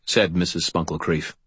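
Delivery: plain reading voice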